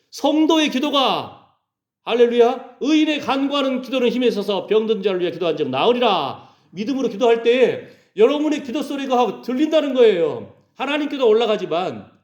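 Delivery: high, forceful pulpit cry; fervent preaching. Real, not acted.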